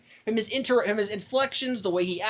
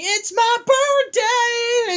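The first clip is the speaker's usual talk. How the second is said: gleeful birthday cry; 14 semitones higher, 5 dB louder, much slower than usual. Retold or imitated. Grandmaster party ship exclaiming.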